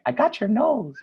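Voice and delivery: high, teasing sing-song; playful baby talk. Acted. Adults playing with the babies, playful high teasing. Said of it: "They're kinda like".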